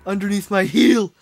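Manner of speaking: loud, high brute threat; barbarian. Acted. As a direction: snarl it like a hulking barbarian.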